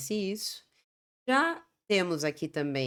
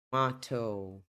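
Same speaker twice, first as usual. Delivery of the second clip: British "tomato" with "mah" in the middle; British English tomato, crisp t; British pronunciation; 6 semitones lower, 3 dB quieter, slower than usual. Shown as a demonstration. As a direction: say tomato as "tuh-MAH-toh". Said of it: "Essa é a diferença entre eles".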